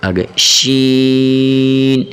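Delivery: long chanted شین; drawn-out sheen. Shown as a demonstration. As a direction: stretch شین slowly. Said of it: "شین کو بھی کھینچیں گے ہم".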